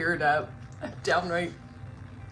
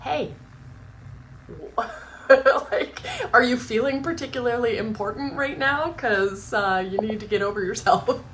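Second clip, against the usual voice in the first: louder, laughing mock scolding of myself; calling myself out; 5 semitones higher, 4 dB louder than usual. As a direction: tease yourself for being self-important. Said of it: "call myself out and go".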